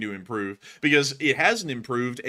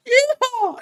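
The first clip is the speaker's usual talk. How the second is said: very high, loud cowboy yeehaw; whooping cheer; 9 dB louder than usual. Acted. Streamer reading a viewer's cheer, high whooping yell. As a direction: let out a piercing, drawn-out whoop.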